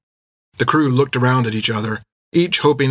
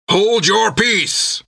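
high, slow commanding cry; 9 semitones higher, much slower than usual. A narrator's reading. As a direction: Jack bellows for peace. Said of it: "cried Jack".

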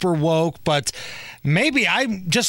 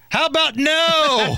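Doctor Evil; 9 semitones higher than usual. Retold or imitated. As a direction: drawl it like a smug supervillain. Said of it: "I believe it was Doctor Evil who once said".

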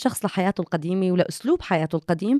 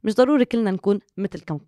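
usual speaking voice

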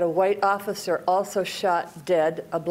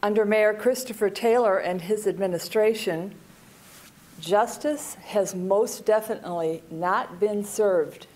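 ordinary talk, nothing marked

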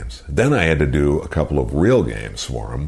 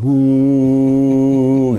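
higher, louder drawn out woo; rally the arena crowd; crowd call; 10 semitones higher, 5 dB louder than usual. Shown as a demonstration. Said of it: "that's what I was trying to create".

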